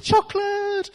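very high, drawn-out mock child voice; children posing for photo. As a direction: imitate a kid holding a camera grin.

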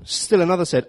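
ordinary talk

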